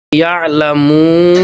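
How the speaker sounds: لیعلمو with the long mu held very slowly; held madd on لیعلمو; slow madd demo. Shown as a demonstration. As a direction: stretch the final مو in لیعلمو. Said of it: "اس کو ہم نے اونچا کر کے پڑھنا ہے".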